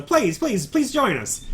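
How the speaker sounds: high-pitched eager plea; pleading invitation. Acted. Host urging visitors to join the meal, pleading. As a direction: beg them, pitched well up. Host begging party to sit.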